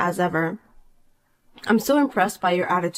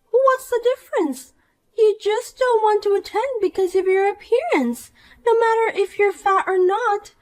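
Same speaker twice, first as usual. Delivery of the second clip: put-on bright, higher voice of a smug mean girl; snide rich girl; 12 semitones higher than usual. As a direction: talk down to her with sweet contempt. Poppy insults Marissa.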